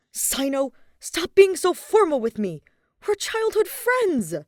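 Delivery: put-on playful complaint to an old friend; whiny queen. A narrator's reading. Queen scolding Sino for formality, loud and whiny.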